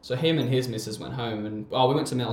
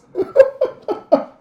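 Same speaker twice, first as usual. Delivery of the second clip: big laugh; 14 dB louder than usual. Real, not acted. Laughing at the punchline.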